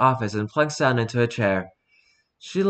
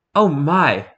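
normal talking voice

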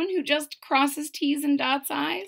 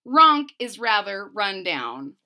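lively, bouncy read-aloud of rhymes; storybook narration; 8 dB louder than usual. A narrator's reading. Reading a rhyming book to a child, lively narration.

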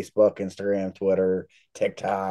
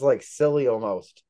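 ordinary delivery

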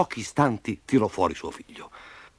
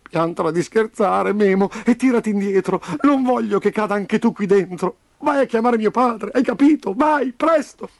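put-on high, insistent child's voice; urgent scolding; 11 semitones higher, 3 dB louder than usual. A narrator's reading. Trapped Roby sending Memo for his father, urgent. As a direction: order him off, rising in urgency. Trapped boy orders friend away.

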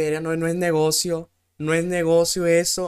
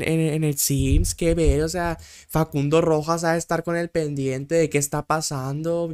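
ordinary delivery

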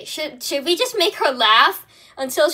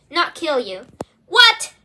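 usual speaking voice

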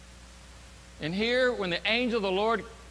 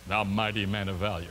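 very low, grand proclamation; mock-solemn; 11 semitones lower than usual. Messenger hailing Gideon, mock-solemn. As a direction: intone it deep with mocking grandeur. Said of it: "perhaps he's mocking".

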